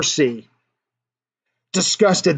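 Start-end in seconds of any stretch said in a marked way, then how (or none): none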